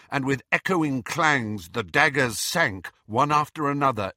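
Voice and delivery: usual speaking voice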